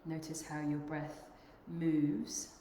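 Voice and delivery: unhurried meditation guide, long pauses between phrases; guided meditation. Acted. Yoga teacher guiding the group to notice their breath, guided meditation. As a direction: lead them gently inward, leaving long silences.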